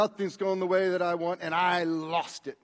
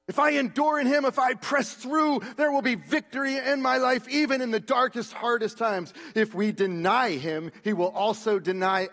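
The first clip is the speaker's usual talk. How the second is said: higher, lifted preaching cry; full preaching; 6 semitones higher, 4 dB louder than usual. Acted. Preacher lifts the congregation toward victory, full preaching. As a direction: preach it with rising force.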